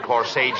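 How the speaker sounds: "corsage" echoed as "cor-say-jee"; mispronunciation quoted. Shown as a demonstration. Straight man correcting his partner, quoting "corsage" mispronounced as "cor-say-jee". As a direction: repeat "corsage" with the wrong "jee" ending. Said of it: "that's corsage".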